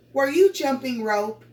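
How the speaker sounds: loud, high-pitched question; question intonation. Shown as a demonstration. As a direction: ask it out loud with YOU lifted high. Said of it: "That's a question".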